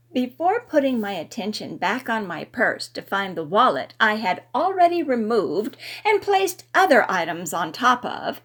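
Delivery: plain reading voice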